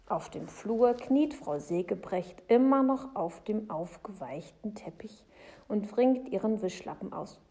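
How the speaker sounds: ordinary delivery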